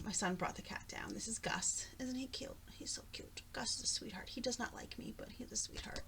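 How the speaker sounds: hushed whisper so the cat stays calm; soft unvoiced whisper about the cat. Real, not acted. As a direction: whisper it, the cat is right there.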